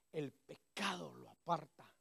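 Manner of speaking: usual speaking voice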